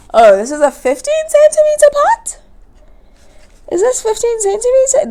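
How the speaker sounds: high, rising unsure question; suddenly doubting the size; surprised question. Real, not acted.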